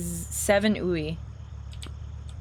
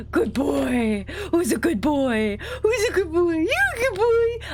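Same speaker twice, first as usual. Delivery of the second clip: put-on high playful baby talk for a dog, climbing to a squeal; gushing dog praise; 8 semitones higher, slower than usual. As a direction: coo it higher and higher, as to a puppy.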